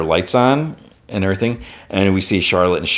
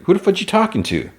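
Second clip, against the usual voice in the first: pitched way up, baffled; high squeaky confused voice; 13 semitones higher than usual. Retold or imitated. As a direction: squeak it out in disbelief. Retold confused character quote.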